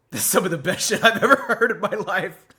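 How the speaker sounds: loud, high, breaking with emotion; overwhelmed praise. Real, not acted. Praising the best song he's ever heard, overwhelmed. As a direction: gush about the song, almost in tears.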